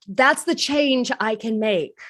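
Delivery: normal talking voice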